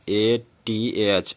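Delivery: letters of ATH spelled out very slowly; slow spelling. Shown as a demonstration. Teacher spelling out अतः in English letters, slowly. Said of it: "इसका एएच हो गया ना".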